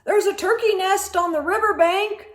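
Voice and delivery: fast, high, loud call. A narrator's reading. Sheep calls out where the turkey nest is. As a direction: call the news quickly. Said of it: "Sheep called".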